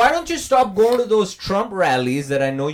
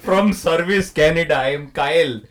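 imitated Indian-accented scam caller; mock-official Indian-accented caller; Kyle. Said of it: "the guy called me".

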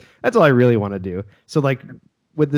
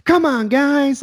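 high squeaky call; 4 dB louder, much slower than usual. Retold or imitated. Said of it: "they're just like".

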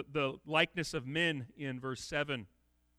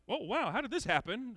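light, higher mock "oh wow" of sudden discovery; mock surprise; 6 semitones higher than usual. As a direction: act out a mild surprised discovery. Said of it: "Surprise, discovery".

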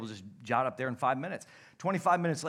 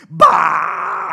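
a wild, much higher and louder battle yell; running scream; 16 dB louder than usual. Retold or imitated. Buddy charging off the cliff, yelling at the top of his lungs. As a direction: let out a full-throated charging yell. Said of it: "screaming while he's running".

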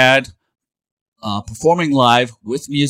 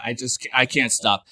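usual speaking voice

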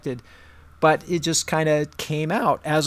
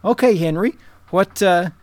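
teacher calling on him; 4 semitones higher, 5 dB louder than usual. Acted. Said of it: "And she's like".

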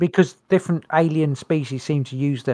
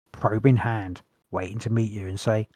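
normal talking voice

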